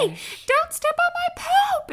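put-on very high, loud panicked child's shriek; mock toddler scream. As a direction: screech it like a kid guarding his mess. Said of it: "he screamed at me".

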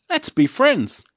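loud, high friendly little hot dog; hot dog's hello. A narrator's reading. Living hot dog greeting Petey, high and loud. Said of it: "says the hot dog".

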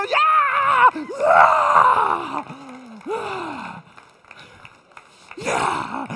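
loud ecstatic yells; excited fan roaring; fan goal shouts. Retold or imitated. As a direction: celebrate the goal with the fans. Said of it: "Y uno".

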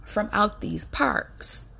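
very slow, trailing off on "from out these parks"; stretched, heavy warning that fades at the end; slow, emphatic warning. Shown as a demonstration. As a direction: spell it out word by word. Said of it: "I'm saying it just like this".

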